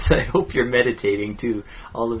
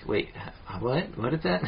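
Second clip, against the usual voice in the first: higher, questioning mock confusion; puzzled listener; 4 semitones higher, 5 dB quieter, slower than usual. Co-host imitating a listener thrown by a German accent, mock puzzled. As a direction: play baffled by what you just heard, half laughing.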